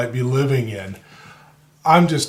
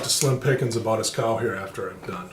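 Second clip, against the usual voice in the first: whispering; 3 semitones lower, 7 dB quieter than usual. Acted. Covering a muttered joke.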